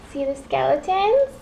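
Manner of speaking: usual speaking voice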